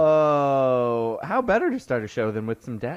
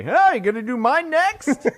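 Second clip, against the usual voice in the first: corny dad voice; 14 semitones higher, 4 dB louder than usual. Retold or imitated. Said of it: "Dad's racing to be the first one to say".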